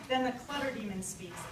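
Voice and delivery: normal talking voice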